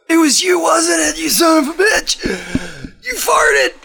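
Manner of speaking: high gasping cries between accusing yells; gasp, then accuse him at the top of your voice; hammed-up choking panic. Choking on the fart smell.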